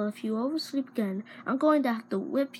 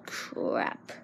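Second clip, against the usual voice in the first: muttered curse; 7 dB quieter than usual. Acted.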